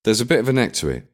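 brisk and practical, breaking the spell; brisk matter-of-fact. A narrator's reading. Woman opening his car door, brisk and matter-of-fact. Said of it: "she said, killing the mood".

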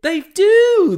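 very high, loud cry of insistence; loud squeal with a drawn-out last word; emphatic shout. Real, not acted. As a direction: shout it out in high-pitched vindication. Emphatically insists after co-host agrees.

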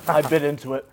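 loud, strained words through a burning mouth; mouth burning from spicy food. Real, not acted. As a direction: blurt it out with the mouth on fire. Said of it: "It's already burning my tongue".

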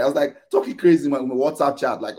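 ordinary talk, nothing marked

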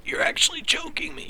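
choked, squeezed high and breathless under a grip on the throat; strangled whisper. Retold or imitated. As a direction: rasp it out as if a hand is on your neck. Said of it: "But it was more like".

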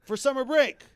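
high, loud incredulous outburst; exasperated inner rant. Retold or imitated. Host venting at Daniel's dumb question, high and loud. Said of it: "I'm thinking to myself".

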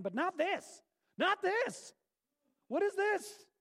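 high, squeaky mock disbelief; a comic squeal of indignation at the ending; mock outrage. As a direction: pitch it up in hammed-up indignation.

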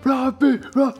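very high, excited call; shouting his name. Retold or imitated. Fans chanting for Brad Pitt, very high shouted call. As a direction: call out to the star at the top of your voice. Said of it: "A voces".

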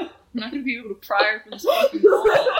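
talking through laughter; words breaking into giggles; laughing. Real, not acted. Laughing at absurd wall question.

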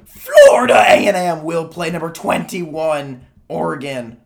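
slow, loud, strained voice going out; voice cracking. Real, not acted. Friend reads the schedule while his voice gives out, loud and strained. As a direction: push through a failing voice. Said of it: "while my voice goes".

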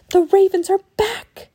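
put-on high, loud warning from a child; alarmed cry. A narrator's reading. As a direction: raise the alarm, frightened. Girl alerts village to ravens.

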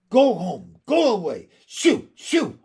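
high, loud, cross commands; angry shooing. A narrator's reading. Miss Lark waving the stray dog away, angry shooing. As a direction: drive the dog off angrily. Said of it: "waving her arms angrily at the dog".

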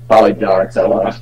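ordinary delivery

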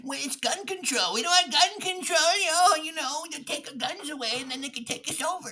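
high put-on voice, rushing the last line; mocking gun nut. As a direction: parody a rambling gun-rights guy. Parodies gun-rights argument.

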